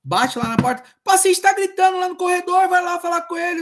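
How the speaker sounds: high, loud mock order to go calm the patient; imitating staff. Social worker mimics staff sending him to a shouting patient, high and loud. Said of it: "eu falo assim".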